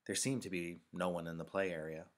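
usual speaking voice

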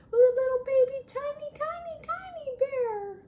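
put-on very high baby-talk squeak; cutesy high-pitched cooing; teeny-tiny voice. Voices how tiny the bear is.

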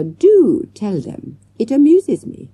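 slow, lazy villainess drawl; a smug captor mocking her prisoners; drawling, amused. A narrator's reading. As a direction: taunt them at leisure, then cut it short. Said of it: "drawled Miss Parker".

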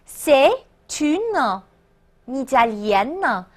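C'est, une, Italienne in separate pieces with pauses; slow, spaced-out C'est / une / Italienne; broken-down liaison demo. Shown as a demonstration. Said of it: "注意连读连诵啊".

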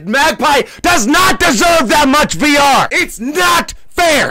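loud, high outraged protest; furious drawn-out shout; mad. Real, not acted. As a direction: yell it slowly, wailing the last words. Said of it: "God, I'm mad! I'm upset!".

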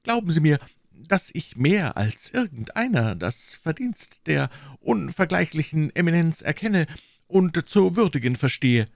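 high-pitched, anxious pleading; timid. A narrator's reading. Prisoner assuring the commissioner of his loyalty, timid and high-pitched. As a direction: plead meekly, pitched well up. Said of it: "erwiderte Herr Bonacieux zaghaft".

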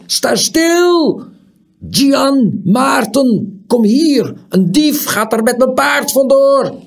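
high, full-volume yelling; shouted alarm. A narrator's reading. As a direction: farmer shouting for help after a thief. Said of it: "riep hij".

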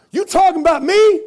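loud, high street challenge; tough guy challenge. Retold or imitated. Tattooed man confronting the preacher, loud and high. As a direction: bark it back at him.

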